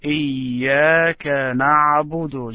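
إياك نعبد with a held pause on إياك; careful recitation. Shown as a demonstration. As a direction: model إياك نعبد, pausing on إياك. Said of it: "Just try to pronounce it this way".

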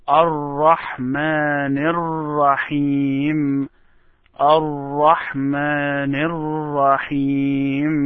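slow chant linking Ar-Rahman into Ar-Rahim; joined words, vowels held long; connected recitation. Shown as a demonstration. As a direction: run الرحمن into الرحيم without a break. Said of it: "connect Ar-Rahman to Ar-Rahim".

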